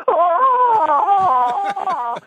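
loud, high re-enacted scream; retold pain scream. Patient getting jabbed near the bone, re-enacted agonized scream. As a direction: belt out a long mock scream of agony. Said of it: "and I started screaming".

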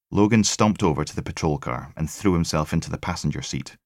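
ordinary delivery

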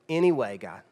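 low, soft, slow begging, trailing off; humble plea. Retold or imitated. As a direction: beg God for one last chance, nearly fading out. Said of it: "Humble Samson".

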